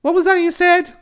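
high, loud startled cry; sharp outburst. Acted. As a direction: blurt it out sharply. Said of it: "A sharp ... escaped my lips".

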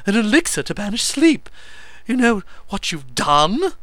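high, loud hysterical squeak; squeaking hysteria. A narrator's reading. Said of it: "he squeaked".

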